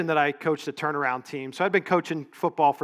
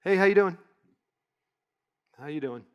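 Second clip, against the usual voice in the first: insincere greeting; 2 semitones higher than usual. Shown as a demonstration. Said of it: "they don't really mean it".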